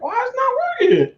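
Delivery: bewildered at a dead machine; exasperated disbelief. Retold or imitated. Retelling his frustration with the Xbox.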